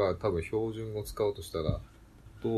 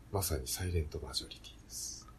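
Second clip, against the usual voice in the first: whisper; 6 semitones lower, 7 dB quieter than usual. Real, not acted. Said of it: "whispered punchline".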